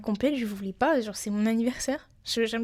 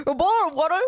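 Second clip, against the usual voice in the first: laughing, louder hammed-up British accent; mock British accent; 5 semitones higher, 5 dB louder than usual. Shown as a demonstration. Trying the Queen's accent again, laughing mock British accent. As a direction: push the posh English accent through laughter. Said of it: "Pardon, c'est très mal dit".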